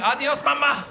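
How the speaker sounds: put-on little boy with a Guatemalan accent. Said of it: "Guatemalan accent when he talks".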